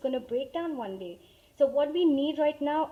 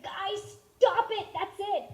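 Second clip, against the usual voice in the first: higher, sharp fed-up order; fed-up mom; 7 semitones higher, 4 dB louder, slower than usual. Retold or imitated. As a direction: snap the kids to a stop. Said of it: "and I was like".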